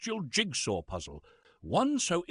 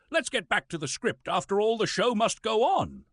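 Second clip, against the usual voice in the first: grandly theatrical; 8 semitones higher than usual. Acted. Actor back to his script, hammy and higher. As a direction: declaim it like a ham actor.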